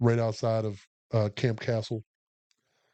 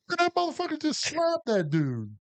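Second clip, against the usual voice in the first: high, incredulous exclamation; retold astonishment; 13 semitones higher than usual. Private telling another about a slap, astonished. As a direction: pitch it way up, astonished.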